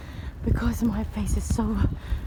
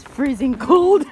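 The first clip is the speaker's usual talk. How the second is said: laughing; 8 semitones higher, 7 dB louder, slower than usual. Real, not acted. Laughing about friend freezing.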